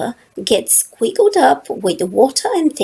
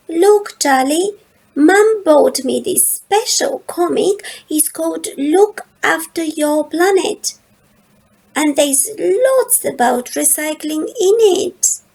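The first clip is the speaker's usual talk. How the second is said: lightly voiced, higher little girl, very earnest; a small girl explaining her big decision; little sister Lola; 7 semitones higher than usual. Little sister Lola telling her big brother Charlie, lightly higher and earnest.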